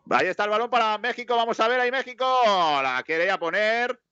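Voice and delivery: put-on slipping into a Mexican commentator accent. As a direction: call the ball like a Mexican commentator. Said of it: "me sale el acento mexicano ya".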